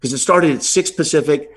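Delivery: ordinary delivery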